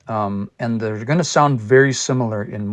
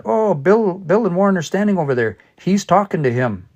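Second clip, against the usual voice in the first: higher, quick chatty voice, only lightly acted; lightly voiced speaker; 6 semitones higher than usual. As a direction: play someone pointing out the brothers. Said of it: "if I said that".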